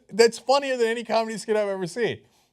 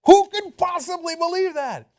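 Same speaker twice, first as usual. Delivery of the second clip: higher, louder incredulous cry; outraged disbelief; 9 semitones higher, 7 dB louder than usual. Real, not acted. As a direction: explode in disbelief.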